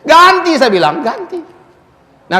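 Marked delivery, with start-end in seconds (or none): 0.1-0.5 high-pitched mock-cross demand; retold anger. Preacher re-enacting his demand to the glass-eater, retold anger. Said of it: "saya marah juga". 1.0-1.4 high, soft little repeat; high soft echo. Retold or imitated. Preacher repeating his demand for the glass, high soft echo.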